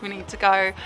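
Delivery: usual speaking voice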